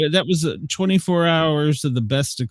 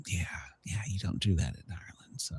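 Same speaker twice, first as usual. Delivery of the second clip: whisper; 7 semitones lower, 15 dB quieter, faster than usual. Acted. Aside about pub etiquette.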